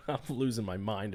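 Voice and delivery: laughing at himself; soft self-mocking laugh. Real, not acted.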